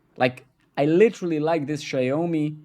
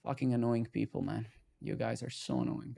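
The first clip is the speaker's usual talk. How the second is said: much softer, grumbling under breath; muttered grumble; 10 dB quieter than usual. Real, not acted. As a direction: grumble it to yourself, half-amused. Grumbling at chat.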